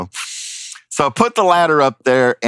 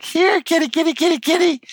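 very high falsetto kitty call; calling a cat. Retold or imitated. Climber trying to get the cat, imitated high-pitched calling. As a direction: coax a cat in a squeaky-high singsong.

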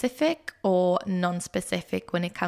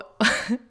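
short laugh; 3 semitones higher than usual. Real, not acted. Laughing mid-sentence.

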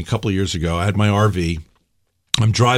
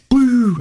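a higher, short electronic boo; mock GPS boo; 12 semitones higher, 3 dB louder than usual. GPS dying mid-praise, a higher mock boo. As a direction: give a quick high boo as the GPS dies.

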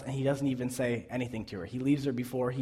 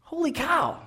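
much higher squeal of disbelief; holy cow, pitched way up in shock; amazed exclamation; 14 semitones higher than usual. Real, not acted. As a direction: react in total astonishment. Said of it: "amazed reaction".

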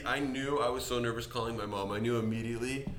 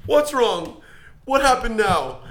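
high, loud, near tears; much higher and louder, a mother near tears; panicked mom; 11 semitones higher, 9 dB louder, slower than usual. Retold or imitated. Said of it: "she was about to start bawling".

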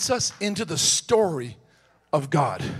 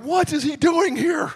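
shocked disbelief; 10 semitones higher than usual. Retold or imitated. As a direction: blurt it out in total shock. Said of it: "I'm like".

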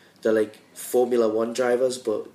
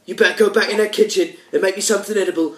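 louder, higher, fast mock chef tirade; Gordon Ramsay rant; 6 semitones higher, 5 dB louder than usual. Mocking the TV chef for friends, fast angry shout. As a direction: tear into the cook at speed. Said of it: "I like Gordon Ramsay".

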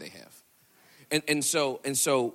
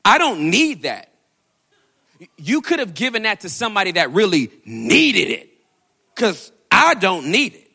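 indignant refusal; 8 semitones higher, 13 dB louder than usual. Retold or imitated. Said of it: "She was like".

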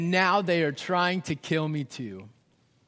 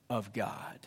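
whispered reverently; hushed awe; 10 semitones lower, 11 dB quieter than usual. Acted. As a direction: breathe the last words. Trails into whisper.

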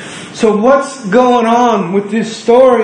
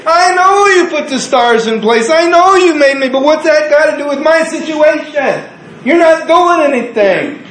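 frustrated believer; 9 semitones higher than usual. Acted. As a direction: cry out to God in exasperation. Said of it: "That's what we say".

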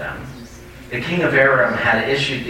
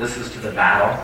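ordinary talk, nothing marked

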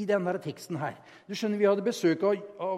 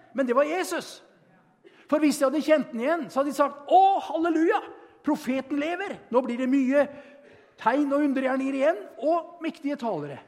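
raised, louder preaching build; fervent preaching; 7 semitones higher, 4 dB louder than usual. Acted. Preacher telling the Emmaus story to the church, fervent. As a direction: drive the story home to the room.

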